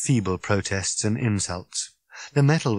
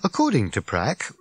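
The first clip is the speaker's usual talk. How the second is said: ordinary delivery, nothing marked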